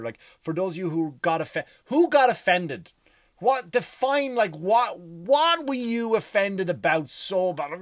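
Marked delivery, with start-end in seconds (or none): 1.9-7.7 incredulous. Acted. Mocking the easily offended.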